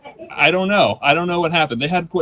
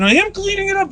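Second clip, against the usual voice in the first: put-on high whiny kid voice, a little louder; daughter's indignant squeal; 4 dB louder than usual. Daughter insisting she is already cleaning. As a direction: squeak it like a protesting ten-year-old. Daughter arguing over spilled soda.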